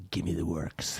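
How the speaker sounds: quiet, breathy put-on Hornet voice. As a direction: hush it down into the Hornet voice. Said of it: "you're gonna use your Hornet voice?".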